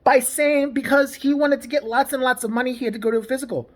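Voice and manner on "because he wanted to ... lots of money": ordinary talk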